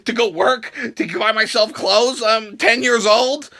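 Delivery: ordinary delivery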